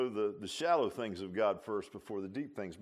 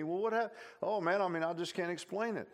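a hint of a fumbling new believer; lightly voiced convert; 6 semitones higher than usual. Preacher voicing the convert answering his friend, lightly acted. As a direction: answer sheepishly, a little higher.

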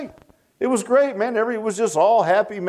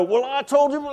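higher-pitched pretend angry retort; mock fit of anger; 7 semitones higher than usual. Arguing spouse snaps back in a debate, mock angry. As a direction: act out a spouse's heated comeback. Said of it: "say something in a fit of anger".

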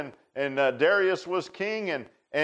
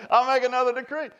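laughing; 7 semitones higher, faster than usual. Retold or imitated. Mocking the unchangeable decree.

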